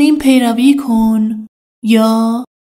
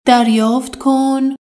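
normal talking voice

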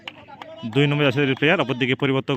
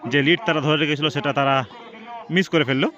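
normal talking voice

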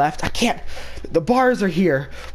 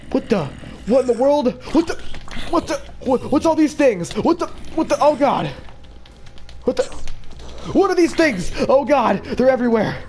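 panicked alarm; 7 semitones higher than usual. Real, not acted. Zombies swarm player in game.

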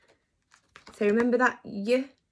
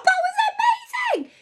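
excited praise; 4 dB louder than usual. Real, not acted. Celebrating children's success.

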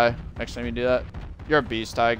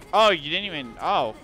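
usual speaking voice

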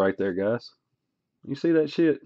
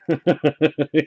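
rapid chuckle; laugh; 2 semitones higher than usual. Real, not acted. Laughing after dumb, dumb, done, chuckling. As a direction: run of short laughing bursts.

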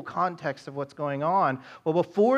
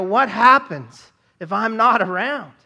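worried father; 5 semitones higher, 7 dB louder, slower than usual. Retold or imitated. Father's imagined worry.

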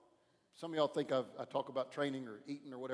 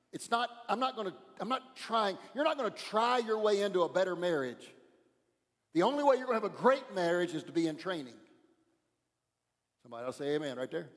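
louder, driving sermon cadence with punched words; preaching at full force; 3 semitones higher, 5 dB louder than usual. Acted. Pastor exhorts the church to train not try, fervent preaching. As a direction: preach it, building the call to train.

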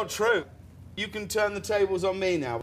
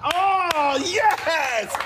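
mock proud exclamation; 7 semitones higher, 6 dB louder than usual.

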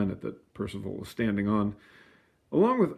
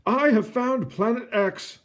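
much higher, slower announcement in a put-on voice; Clyde announcing; 12 semitones higher, 4 dB louder than usual. A narrator's reading. Clyde telling the world of his find, high and slow. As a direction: proclaim the discovery proudly. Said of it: "Clyde said".

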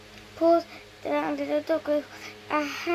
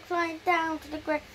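ordinary delivery

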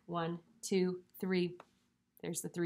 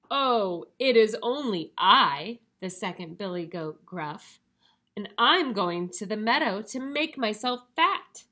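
louder, sturdier billy goat; not-so-small voice; 3 semitones higher, 8 dB louder than usual. Acted. Second goat answers the troll, not-so-small voice. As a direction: speak up as the middle-sized goat. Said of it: "his voice was not so small".

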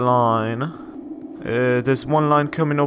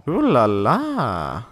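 playful ooh la la; 2 semitones lower, much slower than usual. Pretend. Playful remark at new spot.

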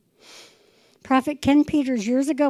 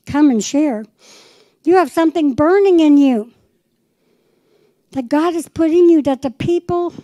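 preaching call; 5 semitones higher, 6 dB louder than usual. Retold or imitated. Preacher quoting her call to Rob.

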